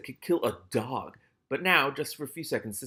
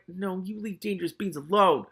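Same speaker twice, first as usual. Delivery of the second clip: pleading protest; 8 semitones higher than usual. Real, not acted. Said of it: "reader pleading with story".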